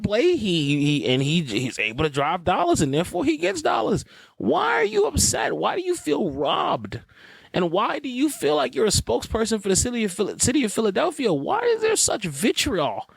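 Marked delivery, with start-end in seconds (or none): 4.4-13.1 incredulous questioning. Real, not acted. Host challenging resentful fans over their outrage, incredulous. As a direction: plead the questions high and stretched.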